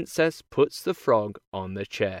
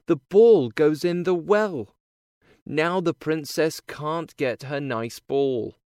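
ordinary delivery, nothing marked

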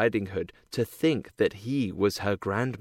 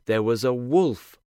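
plain reading voice

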